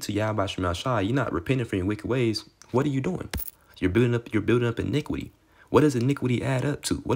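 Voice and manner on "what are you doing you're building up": ordinary talk, nothing marked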